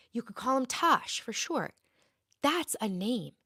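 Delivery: usual speaking voice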